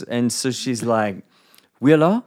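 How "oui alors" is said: French phrase, higher and louder, lilting; sing-songy French. Retold or imitated. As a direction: sing the words like a French girl at her door. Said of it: "in that sing-songy way".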